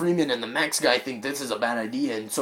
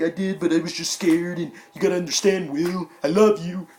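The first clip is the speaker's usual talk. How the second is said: higher and pleading, a touch louder; pleading wife; 7 semitones higher, 4 dB louder than usual. Retold or imitated. Wife pleading with her husband the AI, higher, urgent. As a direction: beg him to believe you.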